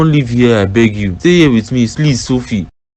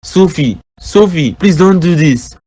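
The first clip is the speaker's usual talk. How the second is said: higher, calling her name, then a slow plea; desperate pleading; 6 semitones higher, slower than usual. Acted. Frank begging Sophie as she leaves, high and pleading. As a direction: beg her not to walk away.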